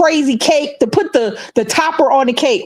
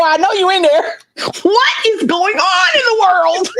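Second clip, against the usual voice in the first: imitating him calling through the door, higher, with a crying edge; pleading boyfriend at door; 7 semitones higher than usual. Ex knocking on her door at night, pleading mock-tearfully. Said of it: "He go knocking on the door".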